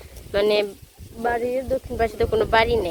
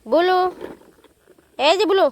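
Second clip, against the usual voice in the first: cooing goodbye; 6 semitones higher than usual. Real, not acted. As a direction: wave the pet off in a sing-song coo. Saying bye to pet Bulu. (বুলুকে উঁচু গলায় আদুরে বিদায়)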